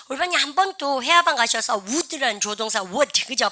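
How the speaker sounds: normal talking voice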